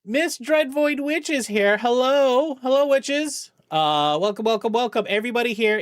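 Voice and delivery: high, loud welcome for the raiders; big excited streamer hello; excited greeting. Real, not acted. Greeting an incoming raid.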